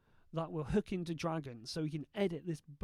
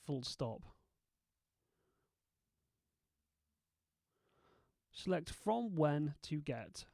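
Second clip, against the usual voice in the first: dictation commands. Shown as a demonstration. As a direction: dictate each word clearly to software. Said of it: "edit this with nothing more than my voice".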